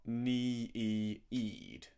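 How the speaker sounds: "nee-eed" drawn out very slowly over three pitch steps; stretched word. Shown as a demonstration. Teacher shows how the singer stretches "need" into three syllables, slow and sung. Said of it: "It's three syllables".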